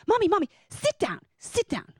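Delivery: put-on high-pitched, insistent little boy; imitating a toddler. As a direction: urge your mother like a two-year-old. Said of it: "he goes".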